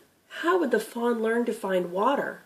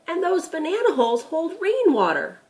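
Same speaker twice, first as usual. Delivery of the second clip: put-on lightly raised, curious boy; a young grandson asking, a bit higher; boy Tim's voice; 9 semitones higher, 3 dB louder than usual. Grandson asking Gramp about the deer, curious boy voice.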